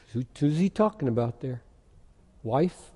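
usual speaking voice

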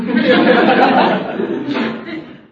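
high breathless laugh; amused laugh. Real, not acted. Preacher laughs at his own mock reply, breathless and high. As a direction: burst out laughing.

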